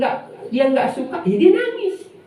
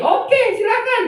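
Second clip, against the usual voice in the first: much higher, eager friendly reply; mock friendly reply; 11 semitones higher than usual. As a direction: answer brightly up high. Acting the listener's reply.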